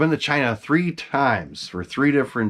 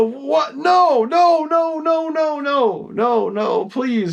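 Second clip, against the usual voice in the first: much higher, repeated no no rising to a plea; retold frantic pleading; much slower than usual. Collector pleading with Frank to send the mask, high frantic disbelief. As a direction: mock-desperate begging, pitched way up.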